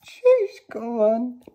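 very high, loud thrilled scream; real scream of joy; excited scream. Real, not acted. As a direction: scream it out with delight. Reliving the Charizard pull.

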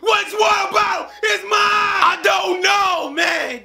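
angry shout. Acted. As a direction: lose your temper and shout. Sketch quarrel escalates to shouting.

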